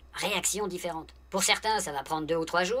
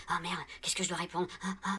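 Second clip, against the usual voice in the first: flustered panic; 6 dB quieter than usual. Retold or imitated. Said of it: "ça a dû lui créer un blanc".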